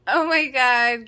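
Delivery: usual speaking voice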